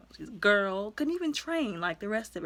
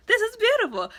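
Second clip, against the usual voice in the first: much higher and louder, swooning; gushing awe; 13 semitones higher, 7 dB louder than usual. Retold or imitated. Viewer gushing at the graceful fight scene, high and swooning. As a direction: swoon over it out loud.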